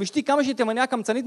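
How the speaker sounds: ordinary delivery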